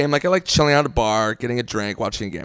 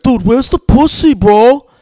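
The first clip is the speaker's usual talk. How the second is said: higher, louder whining bro voice; Russ; 7 semitones higher, 4 dB louder, slower than usual. Retold or imitated. Buddy from Boston always asking his friend, pestering bro voice. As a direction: imitate an urgent, pestering buddy.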